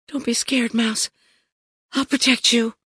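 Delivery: put-on soft, high little-girl reassurance; hushed and protective; soothing the cat. A narrator's reading. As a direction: comfort a scared pet gently, high and soft. Girl comforts her scared cat.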